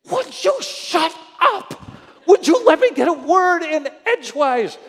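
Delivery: high, loud, frustrated outburst; exasperated yelling. Retold or imitated. Pastor venting at the phone agent who won't stop, yelling. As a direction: yell it like you can't get a word in. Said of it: "I'm just yelling under my breath".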